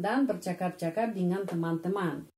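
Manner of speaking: ordinary delivery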